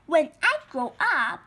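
loud, boastful announcement; a loud boasting young caterpillar; proud. Acted. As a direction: brag it to the frog. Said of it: "he said proudly".

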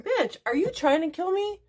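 high-pitched indignant disbelief; retold outrage. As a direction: squeal it in mock panic at being given away. Retold outraged thought at Alexa.

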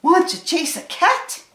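storybook dog voice, punchy and high; barking dog. A narrator's reading. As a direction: ask it as an eager high-pitched dog. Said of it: "barked the dog".

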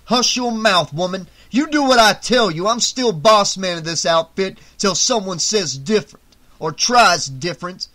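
loud, high-pitched angry orders; domineering gang boss. A narrator's reading. Ali to the woman and Loco, domineering anger. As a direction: lay down the law forcefully.

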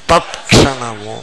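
తత్క్షణము slowly, as tat-ksha-na-mu; correct t before ksh. Shown as a demonstration. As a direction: model తత్క్షణము for repeating, t distinct. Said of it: "అనండి".

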